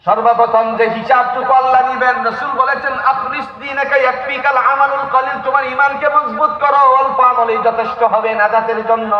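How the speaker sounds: high, sustained sermon recitation; impassioned preaching. Acted. Preacher quoting the Prophet on faith and salvation, impassioned preaching. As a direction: carry the teaching on a high pitch.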